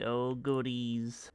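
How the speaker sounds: ordinary talk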